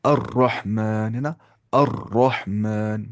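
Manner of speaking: अर्रहमान with a thick doubled r, slow; heavy r. Shown as a demonstration. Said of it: "मोटी आवाज़ से पढ़ना है". (पुर आवाज़ में धीमे से अर्रहमान)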